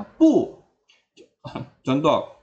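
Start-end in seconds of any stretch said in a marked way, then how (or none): 0.0-0.5 "pu" high, loud and sharply falling; original fourth tone. Shown as a demonstration. Teacher showing the unchanged tone, "pu" falling from high. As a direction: start "pu" high and drop it fast. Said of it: "Tetap dibaca nada aslinya ya".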